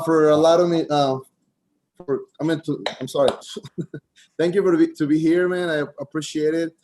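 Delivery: normal talking voice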